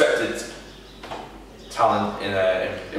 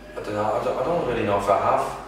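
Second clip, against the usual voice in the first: ordinary delivery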